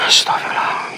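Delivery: real frightened whisper; scared whisper. Real, not acted. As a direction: whisper it so nothing hears you. Frightened ghost hunt at night.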